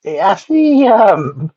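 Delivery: high, choked-up hesitation; tearing up. Real, not acted. Reviewer moved by a film, tearing up. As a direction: start high, fighting back tears. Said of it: "I'm tearing up".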